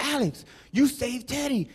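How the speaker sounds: soft, slow admiring onlooker; hushed awe. Acted. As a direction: say it slowly, as if amazed.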